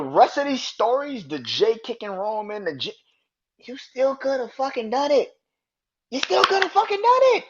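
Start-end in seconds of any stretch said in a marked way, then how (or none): 3.6-5.3 exasperated. Real, not acted. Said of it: "I'm still mad at this shit". 6.1-7.4 much higher, louder, faster repeat; high, fast, furious outburst; real exasperated shout. Real, not acted. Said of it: "I'm still mad at this shit".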